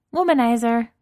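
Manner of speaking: bright, loud, high correction; a happy, loud put-right; cheerful correction. Acted. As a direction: chirp the correction gleefully. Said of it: "Kate corrects cheerfully".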